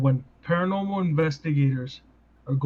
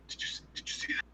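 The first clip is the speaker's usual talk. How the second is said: imitating a tense, barely audible whisper; mock hushed whisper; 15 dB quieter, much faster than usual. Investigators imitated after a door slams, mock whisper. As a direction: whisper it to your partner, voiceless and halting.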